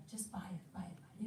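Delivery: mock-seductive whisper of temptation; tempting murmur. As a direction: lure them in quietly. Imitating tempting broadcast.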